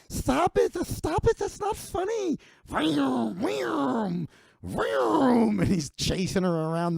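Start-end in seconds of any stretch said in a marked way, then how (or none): 0.1-2.4 very high, shrill panicked woman's squeal; shrieking Sarah. Retold or imitated. Sarah shrieks at Big Mike chasing her. 2.7-5.6 high mock vroom of a dustbuster; vacuum noise. Big Mike revs the dustbuster at Sarah.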